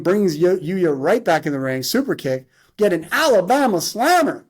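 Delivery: animated, high-pitched ringside call; wrestling play-by-play. Acted. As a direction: punch each big move like an announcer.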